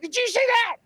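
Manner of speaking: very high-pitched excited cry; startled viewers. Retold or imitated. Guest imitates live viewers reacting, excited and startled. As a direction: squeal it like a viewer who just saw it.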